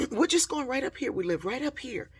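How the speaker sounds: high-pitched, retold politeness; polite kids' answer. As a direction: imitate a youngster explaining eagerly. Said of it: "They were so polite".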